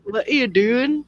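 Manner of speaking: put-on Brummie accent. Mimicking [name]'s Birmingham accent.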